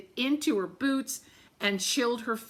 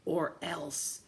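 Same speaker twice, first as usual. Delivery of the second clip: menacing wind; 5 semitones lower, 7 dB quieter than usual. Acted. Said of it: "it shrieked".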